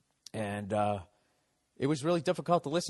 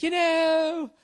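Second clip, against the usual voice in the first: high, loud, slow mock diva voice with dramatic pauses; milk the crowd in a hammed-up pop star voice; 7 dB louder, slower than usual. Comedian mocking a pop star working the crowd, high and hammy. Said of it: "doing that stupid dramatic pause to milk the crowd".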